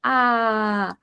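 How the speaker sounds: a short high startled "Ah" at a lion; scared gasp. Shown as a demonstration. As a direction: gasp "Ah" like you just saw a lion, a touch scared. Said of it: "you'll probably be really scared and you'll go".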